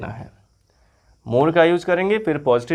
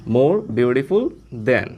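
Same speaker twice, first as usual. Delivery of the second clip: more beautiful than spaced out very slowly; slow model phrase; 2 semitones lower, much slower than usual. Shown as a demonstration. Teacher modelling more beautiful than for students, very slowly. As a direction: read the pattern word by word, slowly. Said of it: "इसके पहले आपको more का use करना होगा".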